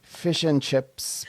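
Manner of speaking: slow, mock Northern Irish; Northern Irish accent. Shown as a demonstration. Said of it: "I think that's more Scottish".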